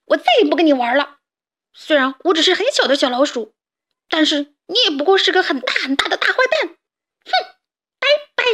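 fast, loud, high furious rant; angry outburst. A narrator's reading. Mouse yelling at tiger, fast furious rant. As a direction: storm off shouting at the bully. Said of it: "我气得大叫起来".

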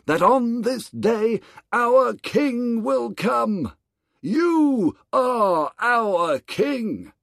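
high, loud, slow proclamation; solemn crowd. Acted. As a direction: crown the boy. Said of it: "said the people".

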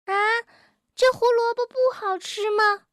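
high-pitched storybook surprise; astonished question. A narrator's reading. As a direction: ask in high-voiced astonishment. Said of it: "蹦蹦惊讶地问". (惊讶地发问)

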